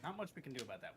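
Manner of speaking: ordinary talk, nothing marked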